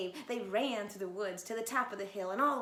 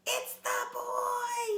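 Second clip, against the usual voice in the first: much higher, louder and slow; excited cry; 7 dB louder, much slower than usual. Acted. Little wolf crying out that the boy is back. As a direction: cry it out in fake alarm. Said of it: "he cried".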